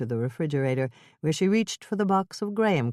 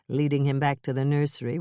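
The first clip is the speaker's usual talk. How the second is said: plain reading voice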